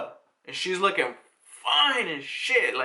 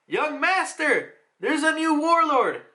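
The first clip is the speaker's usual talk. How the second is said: high-pitched call to a master; urgent announcement; 8 semitones higher than usual. Acted. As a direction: hail the boss with big news. Underling calls out to master.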